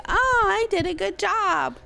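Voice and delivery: high, slow, oblivious pet voice; voicing the dog. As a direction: play a proud little dog. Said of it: "And she's like".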